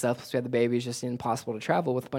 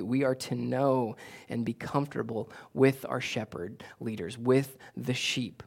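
ordinary talk

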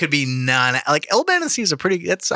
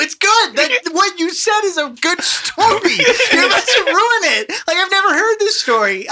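excited laughing protest; 12 semitones higher than usual. Real, not acted. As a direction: beg them to stop, laughing.

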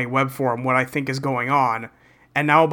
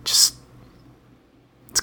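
whispering. Real, not acted. Keeps whispering the opinion.

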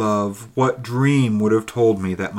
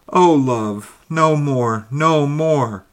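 higher, louder cry of anguish; anguished plea; 6 semitones higher, 7 dB louder, slower than usual. Acted. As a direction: beg him to stop, reliving the pain. Ghost recalls being burned alive.

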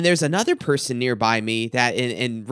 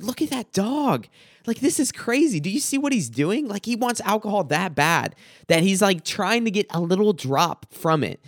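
much higher, excited disbelief at the dog; amazed retelling; 6 semitones higher than usual. Retold or imitated. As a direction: point it out like you can't believe your eyes. Retelling pointing out drinking dog.

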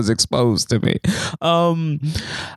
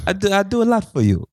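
higher-pitched Italian mobster guilt trip; Italian mafia accent; 6 semitones higher than usual. Acted. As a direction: put on a pleading Italian-American accent. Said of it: "I like to do the accent".

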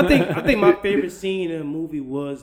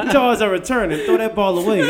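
laughing; 6 semitones higher, 8 dB louder than usual. Pretend. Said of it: "mock trainer recalling Charizard".

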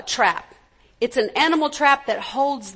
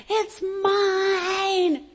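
whiny tattling little sibling; 6 semitones higher than usual. Retold or imitated. Kid claiming toy.

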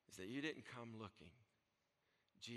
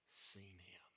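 whisper; 3 semitones lower, 5 dB quieter than usual. Acted. Jesus quote dropped to whisper.

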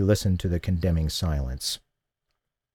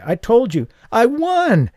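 high, loud excited squeal; giddy high-pitched squeal of a bingo winner; 14 semitones higher, 5 dB louder, slower than usual. A narrator's reading. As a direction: squeal it out, overjoyed. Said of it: "she squealed".

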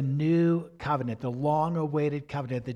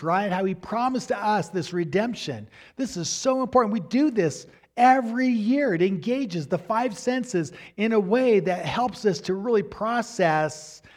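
higher and louder, pressing on with conviction; fervent preaching; 5 semitones higher, 4 dB louder than usual. Acted. Pastor urging believers to keep Passover, higher and louder. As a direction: preach it with rising fire.

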